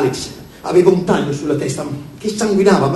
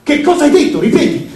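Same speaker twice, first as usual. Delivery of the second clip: angry demand; 8 semitones higher, 4 dB louder than usual. A narrator's reading. Angry demand repeated louder.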